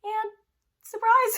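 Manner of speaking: sudden high, loud "surprise"; spring the reveal with a bright yelp; a playful ta-da; mock surprise. Real, not acted. Ironic reveal of lost voice.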